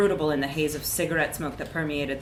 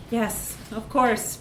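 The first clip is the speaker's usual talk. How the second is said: grudging agreement; 4 semitones higher, much slower than usual. A narrator's reading. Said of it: "she would say".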